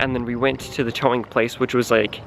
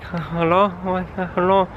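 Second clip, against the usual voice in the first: higher, puzzled hello from the window; confused clerk; 5 semitones higher than usual. Retold or imitated. Towing lady greeting them at 1 am, puzzled higher hello.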